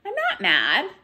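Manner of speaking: lively storybook read-aloud with character voices; picture-book storytime voice; reading to children. Acted. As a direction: read it to a class of little ones. Teacher reads picture book aloud.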